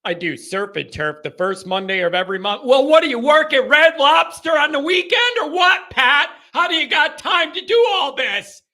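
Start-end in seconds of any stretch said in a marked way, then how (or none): 2.6-8.6 high, loud, incredulous questioning; mock interrogation. Host needling Pat about a weekend job, loud incredulity. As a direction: pester him with raised-voice disbelief.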